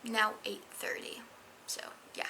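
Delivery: hushed whisper; quiet whispered note. Real, not acted. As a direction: whisper it softly. Whispering the time late.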